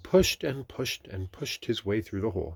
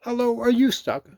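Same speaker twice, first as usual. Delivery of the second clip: very high, loud rabbit voice; inquiring Rabbit; 10 dB louder than usual. A narrator's reading. Rabbit coming upon Pooh stuck, high voice. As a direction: call the question high and loud.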